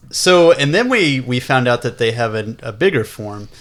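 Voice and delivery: ordinary delivery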